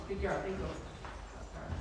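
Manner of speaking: ordinary talk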